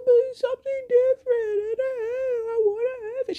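very high mock crying kid; whining, near tears. Little boy protests to mom, tearful mock whine. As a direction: wail it like a pleading small boy. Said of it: "then he starts crying".

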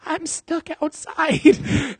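high, slow, pitiful whine of a plea; desperate plea. Retold or imitated. Locked out at four in the morning, whining pitifully to his girlfriend. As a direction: stretch it out like a helpless kid. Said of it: "like, desperately".

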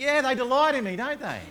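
delighted. Pretend. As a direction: gush over being loved. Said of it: "You think".

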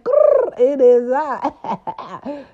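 high growl, then "it is I" slowly drawn out; playful growl. Pretend. Host introducing herself with a hammed-up growl.

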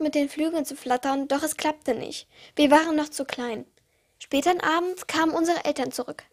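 ordinary delivery, nothing marked

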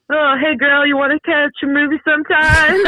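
high, cheesy pickup-line voice with a laugh; laughing at Dracula's lame pickup line; mock smooth Dracula. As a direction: ham up a lame vampire come-on. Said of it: "And he's like".

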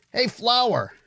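high-pitched taunting hail; needling call. Acted. Soldier taunting lone comrade.

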